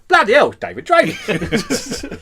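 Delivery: high, slow, exaggerated Cockney exclamation; Cockney accent. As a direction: ham up a broad Cockney accent, laughing. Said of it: "in the most Cockney accent ever".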